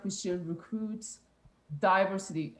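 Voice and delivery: normal talking voice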